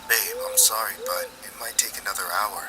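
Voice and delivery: soft apologetic whisper; secretive murmur into the phone; hushed phone whisper. Acted. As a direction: keep it very quiet so dad won't hear. Boy hears dad, keeps hushed.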